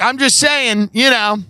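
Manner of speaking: high-pitched, egging-on imitation; playful mock goading. Friend goading his parents to drink, playful mock goading. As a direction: talk them into it with mock innocence. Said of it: "He's like".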